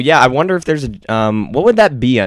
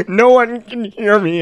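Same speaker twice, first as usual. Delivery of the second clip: laughing lost phone guy; 11 semitones higher, 4 dB louder, slower than usual. Pretend. Lost Verizon man, laughing.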